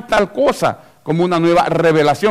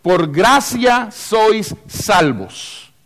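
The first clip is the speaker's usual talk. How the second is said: very slow, word by word; clear, deliberate quoting; 4 semitones higher, much slower than usual. Acted. Preacher quoting Ephesians to make it plain, deliberate. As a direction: spell out the verse with long, separated words. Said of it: "dice clarito".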